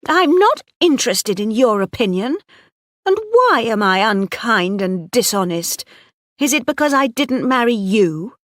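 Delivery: high, loud angry retort; stung fury; angrily. A narrator's reading. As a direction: hurl the words back at him. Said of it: "she said angrily".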